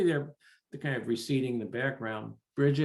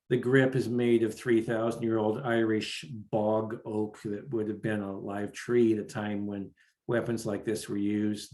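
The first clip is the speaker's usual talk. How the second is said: usual speaking voice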